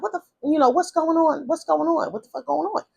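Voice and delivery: high-pitched imitation of an alarmed mother. Mother bursting in on the fight.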